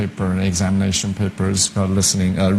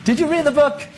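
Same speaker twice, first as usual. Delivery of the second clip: high-voiced teacher. Teacher voiced asking class.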